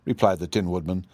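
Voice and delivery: ordinary delivery, nothing marked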